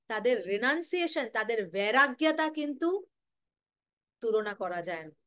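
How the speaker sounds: ordinary talk, nothing marked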